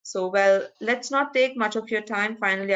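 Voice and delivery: ordinary talk, nothing marked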